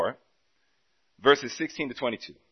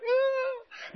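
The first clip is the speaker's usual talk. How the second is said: high surprised squeal of delight; 4 dB quieter than usual. Retold or imitated. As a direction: let out a sudden high squeak.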